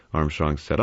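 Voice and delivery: plain reading voice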